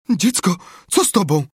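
high, loud worried question; anxious. A narrator's reading. Aunt fearing for the boy, anxious. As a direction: ask the child in alarm.

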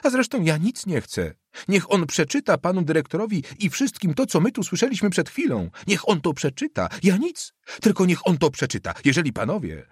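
agitated plea climbing to a high pitch; breaking into treble. A narrator's reading. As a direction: let the voice spill upward in overwrought protest. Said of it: "chrapliwym basem, który co moment przelewał się w dyszkant". (wzburzony głos przechodzący w dyszkant)

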